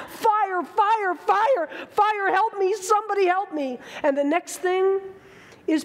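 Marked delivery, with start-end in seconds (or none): 0.1-3.8 higher-pitched mock yell for help; retold cry for help. Swimmer recalling calling to the boat, higher retold yell. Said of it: "and I'm yelling out".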